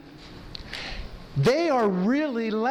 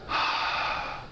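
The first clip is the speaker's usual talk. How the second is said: long audible sigh out; deep sigh out; 5 dB quieter than usual. Shown as a demonstration. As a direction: let the breath go with a sigh. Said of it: "Let it out".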